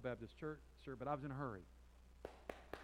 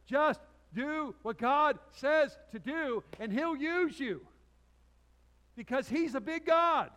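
high, loud, drawn-out proclamation; slow, booming emphasis pitched way up; emphatic preaching shout; 11 semitones higher, 14 dB louder, much slower than usual. Real, not acted. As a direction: proclaim it at full force, stretching each word. Preacher driving home his point.

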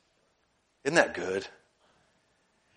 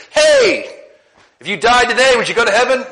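high, loud hailing call to strangers; bright high-pitched street greeting; 10 semitones higher, 8 dB louder than usual. Retold or imitated. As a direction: call out across the room to get their attention. Preacher acts out his street witnessing.

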